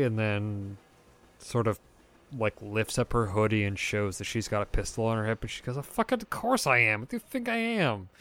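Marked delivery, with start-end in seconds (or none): 5.8-8.1 high, fast and scoffing, as if the question is stupid; scoffing retort. Acted. Photographer scoffing at the player who asked if she's armed. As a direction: toss it back, offended and mocking.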